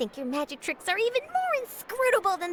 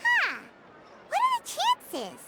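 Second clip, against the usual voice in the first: higher, slow wondering huh; slow, higher, puzzled aside; surprised; 14 semitones higher, slower than usual. Acted. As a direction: mull over the coincidence, surprised. Reporter hears of coincidence.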